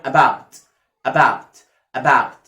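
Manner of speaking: "about" low and loud, open ow; open "ow" model. Shown as a demonstration. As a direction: stretch the ow in "about", falling. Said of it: "مش هقفلها بسرعة". (نطق "about" بصوت آو مفتوح)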